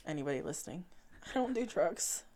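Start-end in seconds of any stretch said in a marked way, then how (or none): 1.3-2.2 shifty denial. Pretend. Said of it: "With my shifty eyes over here".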